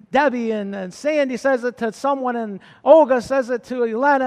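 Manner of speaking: ordinary delivery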